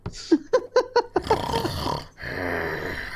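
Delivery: long villainous cackle; drawn-out wicked laugh; evil warlock laugh. Acted.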